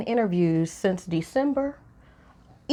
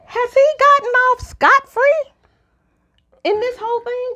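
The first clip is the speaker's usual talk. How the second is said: exasperated; 12 semitones higher, 4 dB louder than usual. Real, not acted. Exasperated rhetorical question.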